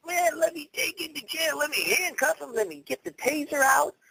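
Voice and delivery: high, mocking voice of a pushy cop; mocking eager cops. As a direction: play the trigger-happy cop, high and gleeful. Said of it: "They're wanting to".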